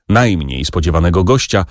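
plain reading voice

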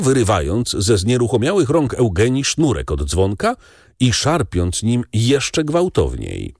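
ordinary delivery

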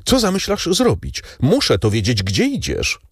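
high-pitched anxious demand, easing down; distraught calling out. A narrator's reading. Woman wringing her hands at the bars, distraught calling out. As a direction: start high and desperate, without extra volume. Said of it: "załamując je zawołała".

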